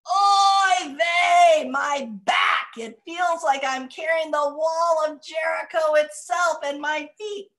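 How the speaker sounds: put-on loud, high complaining old woman; grumpy old woman. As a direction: kvetch loudly like a grouchy grandmother. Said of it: "I get to be very grumpy".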